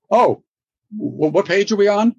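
high, startled, caught out; jolted awake and scrambling, voice jumping up; flustered surprise. Retold or imitated. Caught not following at the read-through, startled and flustered. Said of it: "And I was like".

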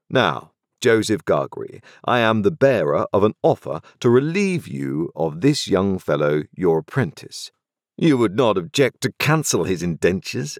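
a crisp, authoritative London lawyer; stern lawyer voice. A narrator's reading. Jaggers questions Joe.

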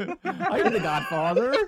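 slow, loud West Country mob boss through laughter; West Country Godfather. Pretend. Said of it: "West Country Godfather".

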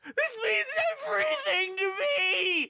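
high, wailing, desperate diva; sputtering foiled villain. Acted. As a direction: shriek it like a ruined star.

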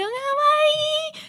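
very high squealed kawaii; cutesy squeal. Retold or imitated. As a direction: squeal it like an anime fan. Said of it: "I go".

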